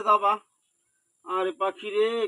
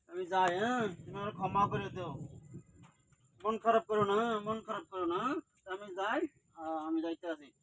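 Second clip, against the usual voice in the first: quiet sad farewell; 8 dB quieter than usual. Real, not acted. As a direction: say goodbye gently, holding back sorrow. Tearful farewell to the animal.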